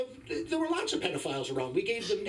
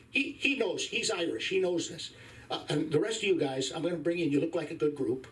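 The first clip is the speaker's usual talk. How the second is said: ordinary talk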